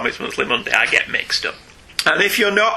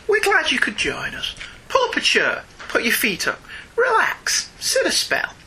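higher, sing-song host welcome; hammed-up cosy welcome; 10 semitones higher than usual. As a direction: lay the hospitality on thick. Hosting a welcome in a sing-song manner.

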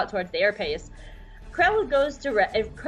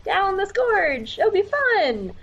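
higher, bright forced cheer, slow start; forced optimism; 9 semitones higher, slower than usual. Retold or imitated. Rex pitching a suicide march to his men, forced cheerful. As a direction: sell a bad plan with a fake upbeat grin. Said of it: "tries to sound as optimistic as possible".

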